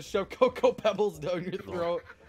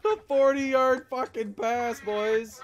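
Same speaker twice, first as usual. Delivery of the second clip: loud, high, laughing hype, slow; excited shout; 8 semitones higher, 10 dB louder, slower than usual. Real, not acted. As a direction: gamer yelling in triumph. Celebrating a big play.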